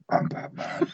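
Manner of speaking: gravelly whispered growl. Acted. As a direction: growl it low and hoarse.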